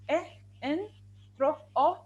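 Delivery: "in" and "tro" each alone, with its vowel isolated; sounding out syllables. Shown as a demonstration. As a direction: model "eh, in", then "tro, o" as separate sounds. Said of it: "You hear the sound".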